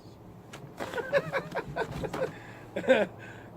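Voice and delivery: shrieking laugh, falling away; loud high cackle; high burst of laughter. Real, not acted. Big laugh at the joke.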